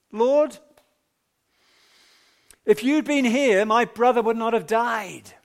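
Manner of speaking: a grieving sister's loud lament; anguished cry. Acted. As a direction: cry it out to the Lord as grieving Martha. Martha's grieving reproach to Jesus.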